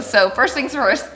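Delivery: ordinary talk, nothing marked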